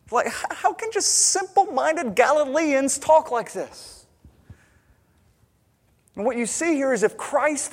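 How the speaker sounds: a preacher at full fire; impassioned preaching. Acted. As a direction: preach it with rising force.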